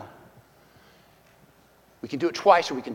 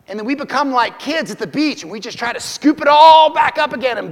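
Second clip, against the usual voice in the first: loud, high preaching, building up; preaching at full force; 8 semitones higher, 10 dB louder than usual. Acted. Preacher warning the church the storm is coming, shouting. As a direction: cry it out over the crowd.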